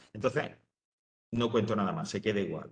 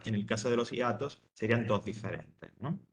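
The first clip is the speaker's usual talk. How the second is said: usual speaking voice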